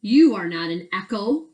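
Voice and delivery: calling out the fake echo; loud, firm correction; indignant. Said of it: "Cork said".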